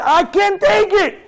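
burn with outrage, dragging out the words; acted zealous fury; righteous anger. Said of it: "That's when the anger gotta come out".

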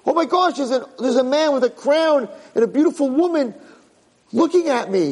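high-pitched amazement; awestruck discovery. Acted. Prince remembering his royal parents, awestruck discovery. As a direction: marvel at a returning memory.

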